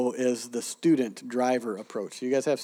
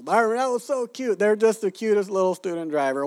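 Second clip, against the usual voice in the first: higher, sugary mock coo over the student driver; gushing condescension; 7 semitones higher, 3 dB louder than usual. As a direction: gush down at the little student driver like a baby. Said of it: "but Americans go".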